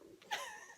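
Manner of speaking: tiny high giggle; laugh. Real, not acted. Giggling about still wanting his number. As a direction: giggle at her own admission. Laughing at her own confession.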